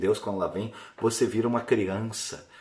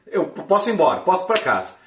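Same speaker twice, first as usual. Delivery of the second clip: high, louder eager plea; eager insistence; 7 semitones higher, 5 dB louder than usual. Retold or imitated. Patient telling doctor he can go home, eager.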